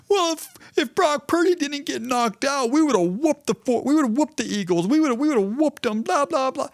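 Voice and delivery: high whiny mocking voice; high, nasal-free whiny mock of complaining players. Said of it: "all the players did was bitch and moan and cry".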